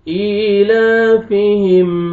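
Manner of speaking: slow chanted ईलाफिहिम रिहलतश्शिताई, vowels held long; tajweed recitation. Shown as a demonstration. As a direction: recite it melodically, lengthening the madd. Full verse recited melodically.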